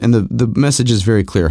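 normal talking voice

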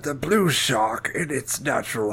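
slow, put-on documentary voice; nature documentary narrator. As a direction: narrate solemnly as if observing wildlife.

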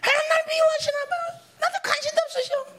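high-pitched whine of grievance; mock complaining. Resentful believer complaining that God ignores them, mock whining. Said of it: "막 이런 말들이 나오는 거죠".

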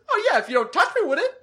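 high, fast, laughing take on the masseuse; imitating the masseuse, laughing. Retelling the masseuse's flippant answer, high, fast and laughing. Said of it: "She goes".

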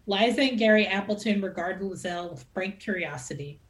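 usual speaking voice

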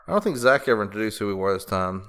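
ordinary delivery

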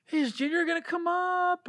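high, breathy fanboy voice; star-struck fan impression; mocking the fans. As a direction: imitate the star-struck fans asking eagerly. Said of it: "That's how they sounded".

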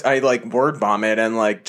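ordinary delivery

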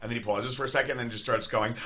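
normal talking voice

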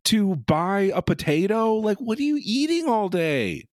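sustained high-pitched disbelief; incredulous. Real, not acted. Co-host addressing absent woman who never shops, incredulous.

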